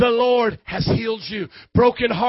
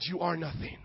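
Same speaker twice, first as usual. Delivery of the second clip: normal talking voice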